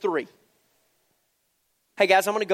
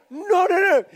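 much higher mock-panicked no's; alarmed dad; 11 semitones higher, 5 dB louder than usual. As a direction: replay the dad's horror as the kick lands. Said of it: "I'm like".

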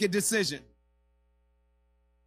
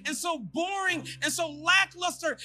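higher, building preacher's shout; preaching at full force; 13 semitones higher, 4 dB louder than usual. Acted. Pastor urging the congregation not to look back, rising shout. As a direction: drive it home to the crowd.

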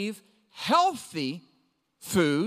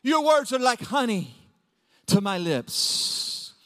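hammed-up devotional rapture; 3 semitones higher, slower than usual. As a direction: swoon over every phrase, overdoing the adoration. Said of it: "start your day reading the Bible like that".

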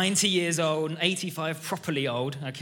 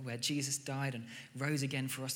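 normal talking voice

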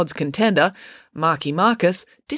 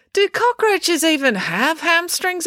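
put-on high-pitched, slow, puzzled girl; April's puzzled question; 13 semitones higher, 3 dB louder, slower than usual. April questions the hamstring remark, high and puzzled. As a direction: ask it high and drawn out.

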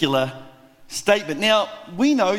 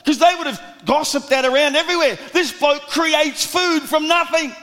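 fiery preaching; 8 semitones higher than usual. Acted. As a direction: preach it out to the whole crowd. Preacher building to full force.

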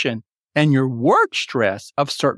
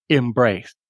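"embraced" said alone, weight on BRACED; second-syllable stress; 4 semitones lower than usual. Shown as a demonstration. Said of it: "the accent falls on the second".

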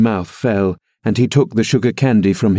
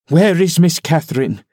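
a sternly demanded question, pitched up; 6 semitones higher than usual. A narrator's reading. Nelly sternly questions Linton to frighten him. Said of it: "I demanded sternly".

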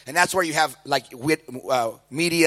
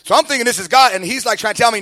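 normal talking voice

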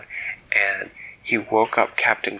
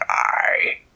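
gruff pirate captain; 3 semitones lower, 4 dB louder than usual. Acted. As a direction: scoff at the boy like an old sea dog.

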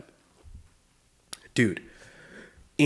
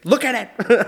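loud, high mock-frantic mother; hammed-up exorcist mom; urgent mom; 11 semitones higher, 6 dB louder than usual. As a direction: imitate a mom commanding her child in alarm. Mother thrusts framed prayer at child.

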